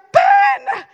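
piercing high shout; yelling for Ben. Retold or imitated. Wife falling through the floor, yelling for husband. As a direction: scream his name across the room. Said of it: "Sorry, I'm probably yelling too loud".

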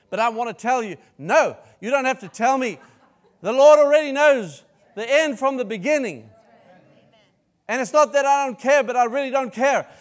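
loud, high, rising preacher's climax; preaching at full force. Acted. Preacher building his point loud and high, ending in a laugh. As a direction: drive it home to the congregation.

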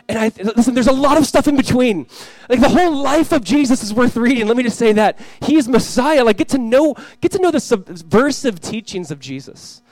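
loud, high, urgent exhortation; emphatic preaching. Real, not acted. Preaches with rising force.

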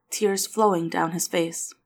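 usual speaking voice

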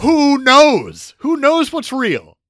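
loud, high exclamation; high-pitched, exasperated holler; exasperated outburst. Real, not acted. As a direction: throw the question out at full volume. Exasperated outburst over what is real.

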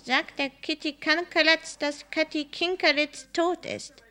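high, drawn-out cat voice; high-pitched feline messenger; catty voice. A narrator's reading. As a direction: speak as an eerie talking cat, pitched well up. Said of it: "mit einer katzigen Stimme gesagt".

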